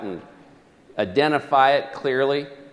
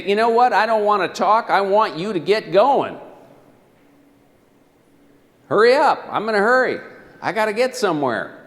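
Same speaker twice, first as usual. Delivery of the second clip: impatient passenger; 6 semitones higher, 3 dB louder than usual. Pretend. Impatient cab passenger role-play.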